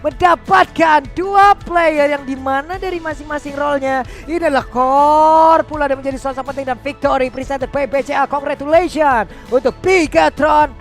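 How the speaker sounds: fighting game announcer. Real, not acted. Victory announcement.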